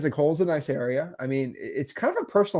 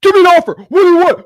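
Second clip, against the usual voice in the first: much higher, louder, pushy demand; aggressive seller; 13 semitones higher, 12 dB louder, faster than usual. Retold or imitated. Pushy seller demanding an offer on the phone, much higher and louder. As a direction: push hard, like a seller who wants numbers now. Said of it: "super, like, aggressive".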